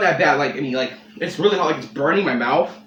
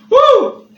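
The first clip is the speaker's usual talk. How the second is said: pained whoop; 11 dB louder than usual. Real, not acted. Burning mouth reaction.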